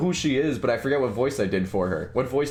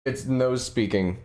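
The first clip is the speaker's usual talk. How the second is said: usual speaking voice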